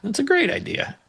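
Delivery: high, eager praise; retold eager approval; enthusiastic agreement. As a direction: hype the idea up. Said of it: "I was like".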